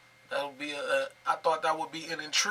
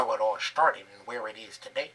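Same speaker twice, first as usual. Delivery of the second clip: mocking the narrator; 8 semitones lower, 3 dB louder than usual. Reactor mimicking the documentary narrator, deep put-on voice. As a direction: echo the narrator line in a lower put-on voice. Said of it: "It sound like a, uh, a Southern E forty".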